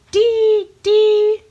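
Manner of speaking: high, loud repeated birdy "dee"; bright bird-call imitation; chickadee call. Shown as a demonstration. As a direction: chirp it like a little chickadee. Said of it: "the sound the chickadee makes is".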